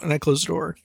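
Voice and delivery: usual speaking voice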